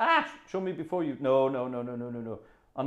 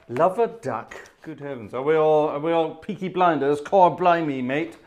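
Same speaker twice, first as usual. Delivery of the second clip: hammed-up cockney geezer; mock London cockney, chirpy; cockney accent; 4 semitones higher, 6 dB louder than usual. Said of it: "Nobody actually talks like that".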